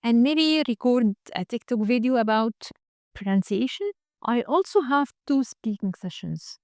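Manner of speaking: slow, measured English to-do list; slow demo voice note. Shown as a demonstration. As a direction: talk it through slowly like a voice note. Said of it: "زي ما أنا هعمل دلوقتي كده". (فويس نوت بالإنجليزي ببطء)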